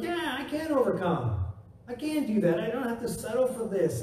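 chest out confident. Retold or imitated. Preacher showing the faith-filled believer, proud and upbeat. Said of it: "your chest pops out".

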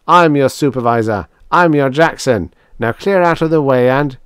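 loud, high proclamation to a crowd; shouting down a horde; defiant command. A narrator's reading. Defiant man commanding the robot horde.

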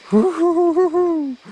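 long high, loud drawn-out whooping; victory whoop. Real, not acted. Celebrating the catch.

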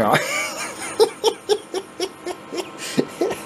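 long, high wheezy chuckle in pulses; real laugh. Real, not acted. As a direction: laugh it off in a drawn-out run. Laughs at his betting losses.

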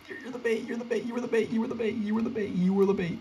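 high, playful mocking chant; teasing singsong. Real, not acted. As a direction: rub it in playfully. Teasing teammate who got caught.

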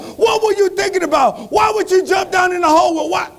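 high-pitched, shouted angry scolding; exasperated trapped man. Acted. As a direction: yell at your would-be rescuer in disbelief. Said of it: "the man said".

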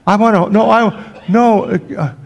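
high, loud tongue-tied protest; flustered shepherd. Pretend. Shepherd sputtering to claim his turn with the baby. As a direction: sputter as you try to get a word in.

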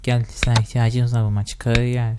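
ordinary talk, nothing marked

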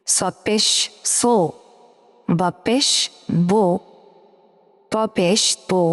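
ordinary talk

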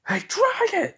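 high, loud call to the dragon; battle shout. Acted. Naruto challenging the dragon mid-fall, shouting high. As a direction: yell it up at the beast.

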